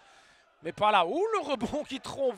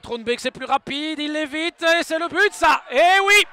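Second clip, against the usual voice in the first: excited sports commentary; 5 semitones higher, 11 dB louder than usual. Real, not acted. Goal scored, commentator erupts.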